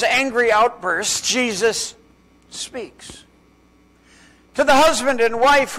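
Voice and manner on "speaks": a breathy whisper on the last word. Acted. Preacher lands the refrain for the haunted father, whispered. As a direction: drop to a whisper to land it. Dramatic refrain after Jesus.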